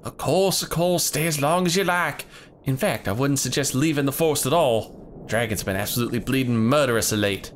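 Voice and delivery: put-on hunter leader in a southern accent; rough hunter greeting visitors in a southern accent.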